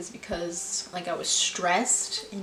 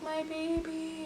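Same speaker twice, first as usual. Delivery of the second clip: high, slow, wailing imitation of a crying mom; mock bawling mother; 9 semitones higher, 6 dB quieter, slower than usual. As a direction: lightly imitate mom sobbing over her baby.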